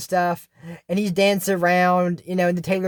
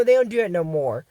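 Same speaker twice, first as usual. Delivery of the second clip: usual speaking voice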